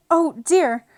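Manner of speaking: high, loud dismayed cry; loud, high "oh, dear"; sorrowful cry. Acted. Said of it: "in a sorrowful tone".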